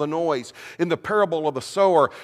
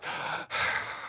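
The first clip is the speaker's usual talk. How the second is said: heavy sigh; 10 dB quieter than usual. Acted. Martha sighing at her sister to make a point. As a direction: sigh out the frustration. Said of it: "Maybe she sighs".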